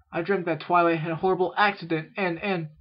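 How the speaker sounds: put-on high, loud, faltering young voice; upset filly. A narrator's reading. Filly telling her sister about the nightmare, high and upset.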